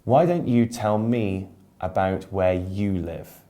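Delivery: ordinary talk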